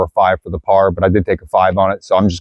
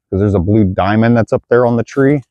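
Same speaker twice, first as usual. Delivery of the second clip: ordinary delivery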